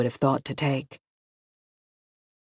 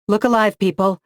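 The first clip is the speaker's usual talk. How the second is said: louder, higher battle call; rally the group before a fight; commanding cry; 6 semitones higher, 5 dB louder than usual. A narrator's reading. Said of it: "she said, raising her sword".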